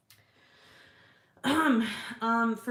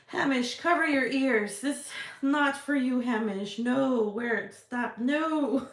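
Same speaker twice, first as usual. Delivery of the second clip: higher, louder mock-panicked voice; silly put-on voice; 4 semitones higher, 5 dB louder, slower than usual. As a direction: shoo Hamish away in a goofy voice. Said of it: "where this voice came from".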